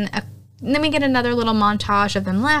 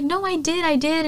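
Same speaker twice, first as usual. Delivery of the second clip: high mock protest; 7 semitones higher than usual. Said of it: "He's like".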